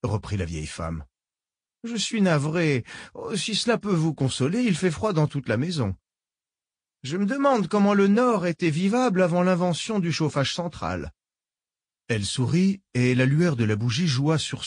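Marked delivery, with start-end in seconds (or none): 1.8-11.1 put-on higher, sweetly menacing old lady; genteel old woman, falsely kind. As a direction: purr it like a polite old villain. Old woman Mélanie speaks.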